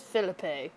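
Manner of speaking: PHILIPPE in three syllables, "fil-uh-PAY"; Spanish-style pronunciation. Shown as a demonstration. Vlogger guessing the name is Spanish, "fil-uh-PAY". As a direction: end "Philippe" on a "pay" sound. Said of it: "Maybe it's Spanish".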